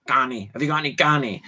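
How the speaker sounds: rough common-lad voice, a touch put on; a nose-picking northern lad asking for crab. Viewers hear a rough lad mocked, common-lad voice. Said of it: "really not northern, you know, picking his nose lad".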